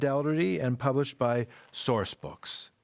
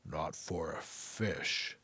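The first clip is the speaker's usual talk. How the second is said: slow, softer wise tree voice; tree gently reasoning with the boy, slowly; 6 semitones lower, 10 dB quieter, slower than usual. Acted. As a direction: speak slowly like an ancient tree. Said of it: "the tree explained".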